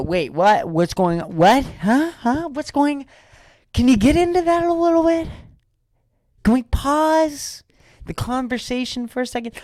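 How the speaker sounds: escalating double take, climbing very high; incredulous curiosity. Acted. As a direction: act baffled and eager, voice shooting up into a squeal. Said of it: "you just at that moment wanna go".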